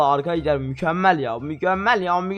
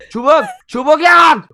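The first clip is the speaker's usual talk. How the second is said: panicked cry; 9 semitones higher, 10 dB louder than usual. Real, not acted. Panics, no sticks.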